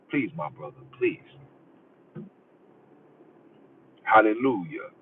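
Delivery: low, soft, hushed pleading brother; mock pleading preacher. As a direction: beg him to repent, hushed and low.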